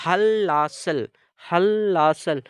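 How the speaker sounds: हल लासल, pressing each l; emphatic Korean pronunciation drill. Shown as a demonstration. Teacher loudly drills students on हल लासल. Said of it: "को पढ़ेंगे".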